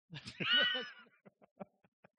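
a sudden loud burst of high laughter; big laugh. Real, not acted. Pastor laughing at his own habit of falling on his face, loud laughter.

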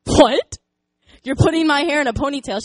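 put-on can't believe what the kid is doing; incredulous question. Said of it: "I'm like".

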